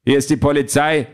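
commanding police officer calling out; police at the door. A narrator's reading. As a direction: announce yourself through a closed door. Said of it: "hämmert jemand gegen die Tür".

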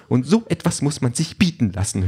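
high, huffy complaint; indignant drone. A narrator's reading. Drone flies off complaining to itself, huffy. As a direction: grumble in an affronted high robot voice.

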